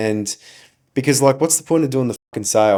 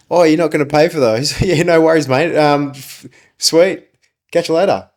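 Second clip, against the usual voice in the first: breezy manager; 6 semitones higher, 4 dB louder than usual. Retold or imitated. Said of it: "the manager that we had at the time was just like".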